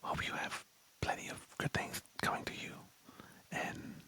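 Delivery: ASMR whisper close to the mic; soft ASMR whisper; whisper in left ear. Pretend. ASMR bit continues.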